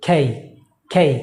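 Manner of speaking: খেই with a puffed k and gliding vowel; correct pronunciation. Shown as a demonstration. Teacher modelling the correct letter name, aspirated k. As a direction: breathe out on k, glide through খেই. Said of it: "যেমন এটাকে বলছি".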